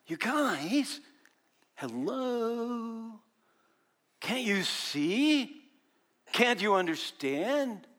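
slow, pleading exasperation; teacher fed up with slow students; exasperated. Acted. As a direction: draw out can't you see. Preacher voices exasperated Jesus.

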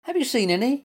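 high, eager little question; squeaky. A narrator's reading. Little Mutt asking the squirrel about treasure, squeaking. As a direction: ask in a tiny high squeak. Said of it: "he squeaked".

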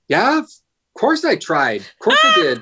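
put-on squeaky kid insisting he tried; imitating son. Dad retelling his son's toilet story, high defensive kid voice. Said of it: "And he's like".

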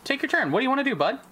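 high-pitched prompt. Real, not acted. DM prompting player, sudden high voice.

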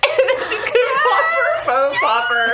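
loud, high, laughing. Real, not acted. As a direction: shout it through laughter. Laughing shout.

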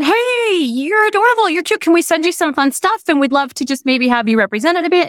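high, singsong gushing greeting; gushing outreach. Retold or imitated. Reaching out to an adorable young teacher, gushing. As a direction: coo it high, easing down into the pitch.

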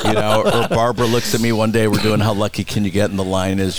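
usual speaking voice

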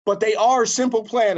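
loud, high band introduction; stage announcement. Retold or imitated. Retold joint announcement to the crowd.

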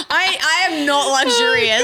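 laughing through the words. Real, not acted. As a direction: crack up while saying it. Laughing at herself.

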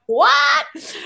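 high, loud mock-shocked cry; re-enacted stunned outburst. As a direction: burst out in amazed disbelief. Acts out own shocked reaction.

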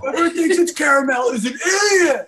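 high-pitched hammed-up heckling; mock angry yell. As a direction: imitate an outraged customer's high shout. Acting out opposing side of fight.

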